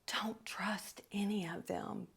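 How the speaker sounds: hushed, secretive whisper; imitated whisper. Man whispers a warning to her about the others, imitated whisper. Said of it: "and he whispers".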